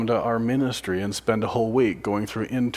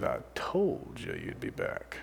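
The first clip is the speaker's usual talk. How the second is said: whispered, low and much softer, coldly knowing; 10 semitones lower, 11 dB quieter, slower than usual. Acted. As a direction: hiss it like a smug father. Cold father greets returning son.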